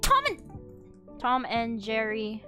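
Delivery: normal talking voice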